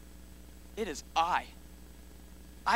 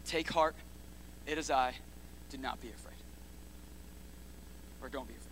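quiet, gentle reassurance; soft reassurance; 5 semitones lower, 12 dB quieter than usual. Shown as a demonstration. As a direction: lay each word down softly. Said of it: "Eight words".

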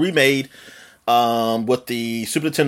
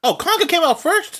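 surprised; 14 semitones higher than usual. Real, not acted. Learns Konga came first.

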